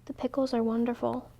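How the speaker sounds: whisper. A narrator's reading. Mouse sadly praising pickles, soft near-whisper. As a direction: murmur it sadly under the breath. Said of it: "whispered Mouse".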